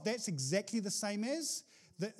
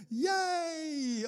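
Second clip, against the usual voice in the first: sarcastic yay; 7 semitones higher than usual. Acted. Sarcastic cheer about elections.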